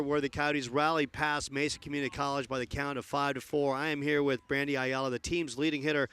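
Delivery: upbeat postgame interview voice; bright broadcast sideline read; sideline reporter. Acted.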